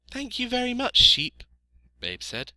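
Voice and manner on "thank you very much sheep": very high, loud piglet voice; little piglet, grateful. A narrator's reading. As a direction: thank them as a small pig.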